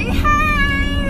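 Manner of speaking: high, drawn-out mock greeting yell; imitated calling out. Former coworker hailing someone in the street, imitated call. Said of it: "just fucking screamed out".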